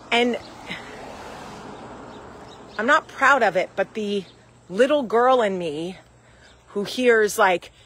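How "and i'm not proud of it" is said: normal talking voice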